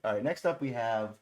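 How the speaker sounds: ordinary talk, nothing marked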